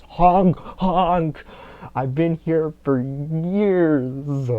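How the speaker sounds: high, loud clownish honking; silly clown. Pretend. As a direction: honk it out like a goofy clown. Honking her own horn, clowning.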